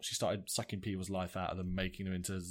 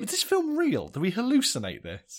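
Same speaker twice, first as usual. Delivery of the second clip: incredulous; 10 semitones higher, 7 dB louder than usual. Real, not acted. Disbelief at absurd award.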